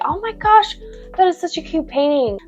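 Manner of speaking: sweet over-the-top patient small talk; gushing to a patient. Retold or imitated. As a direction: gush over the painting to win them over. Gushing to patient about painting.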